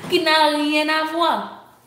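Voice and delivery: high, loud, stretched-out exclamation; slow, high-pitched, gleeful insistence; amused emphatic repeat. Real, not acted. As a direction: draw it out high, tickled by the mismatch. Amused repeat of her own punchline.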